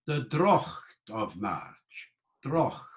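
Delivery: slow "draught" as DROCHT, like Scottish loch; velar fricative. Shown as a demonstration. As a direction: rasp the "ch" in "draught" at the back of the mouth. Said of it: "the ch sound".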